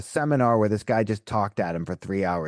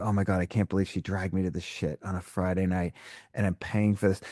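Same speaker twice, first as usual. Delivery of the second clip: low, muttered grumble of a dragged-along husband; a hint of a reluctant husband muttering; grumbling skeptical husband; 5 semitones lower, 5 dB quieter than usual. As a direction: grumble like a man forced to come. Imagined skeptical husband's thoughts.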